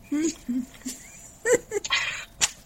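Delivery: bright real laugh, rising high. Real, not acted. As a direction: laugh out loud at the contradiction. Reader laughs at the story.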